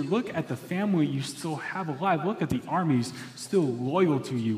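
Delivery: Are you serious, hammer it at the king; exasperated rebuke. Acted. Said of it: "a shocking response from Joab".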